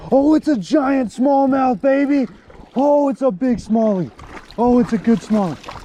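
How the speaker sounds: high, loud whoop of real excitement; hyped up. Real, not acted. Angler shouting over a giant smallmouth on the line, hyped. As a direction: holler it, thrilled and high-pitched. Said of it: "I'm sorry I'm so hype".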